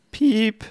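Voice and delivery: high mock bleep; censor bleep. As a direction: say "Piep" like a censor beep, high. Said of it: "Sag es nicht".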